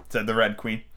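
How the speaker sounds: ordinary delivery, nothing marked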